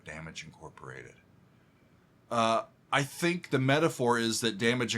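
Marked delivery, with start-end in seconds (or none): 0.0-1.1 whisper. Shown as a demonstration. Fan quoting the song's whispered title line, hushed. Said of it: "and then the whisper".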